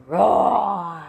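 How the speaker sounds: low growled roar; mock roar. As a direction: roar like a big machine. Bulldozer roar in story.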